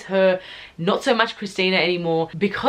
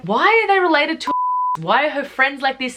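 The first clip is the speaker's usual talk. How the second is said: pitched-up exasperated why; exasperated disbelief; 8 semitones higher than usual. Retold or imitated. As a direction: throw the question up in disbelief. Baffled friends asking what's happening.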